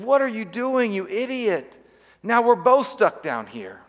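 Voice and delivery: high, incredulous scolding; a touch of mock outrage at the friend; exasperated. Man in the pit scolds the friend who jumped in, exasperated.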